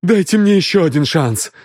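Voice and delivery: high-pitched, emphatic begging; desperate plea. A narrator's reading. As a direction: beg out loud in despair. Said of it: "воскликнул он в отчаянии".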